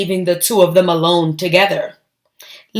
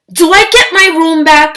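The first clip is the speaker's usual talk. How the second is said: put-on high, fast, hopeful little-girl voice; eager child's question; 8 semitones higher, 6 dB louder, faster than usual. Lily asking her parents about her room, high and hurried. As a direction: pipe up like an impatient child. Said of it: "she asked".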